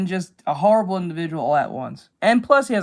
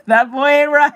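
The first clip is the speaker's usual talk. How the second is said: louder and higher, laughing through it; laughing catchphrase; 6 semitones higher, 7 dB louder than usual. Retold or imitated. Streamer repeats the catchphrase to chat, laughing loudly. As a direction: burst out with the line amid laughter. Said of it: "Hank Hill catchphrase".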